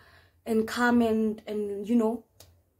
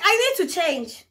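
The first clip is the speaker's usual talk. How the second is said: higher, louder, slower demand; insistent repeat; 7 semitones higher, 6 dB louder, slower than usual. Retold or imitated. Patient to companion who said "Huh?", loud insistent demand. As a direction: spell it out loudly and slowly.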